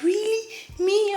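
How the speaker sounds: put-on high, loud girlish disbelief; over-the-top delighted surprise, acted out; surprised mock squeal. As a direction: squeal it as if unable to believe it's you. Girl's surprised reaction, retold.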